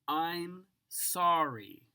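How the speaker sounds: plain reading voice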